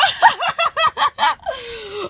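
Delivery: high, long excited laugh; overjoyed laughter. Acted. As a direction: burst out laughing with joy. Overjoyed at reunion.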